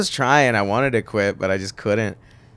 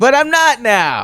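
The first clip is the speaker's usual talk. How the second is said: high, loud, drawn-out mock cheer; hammed-up cheerful announcement; mock upbeat; 12 semitones higher, 6 dB louder than usual. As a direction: swing suddenly into showy good news. Joking lift after grim story.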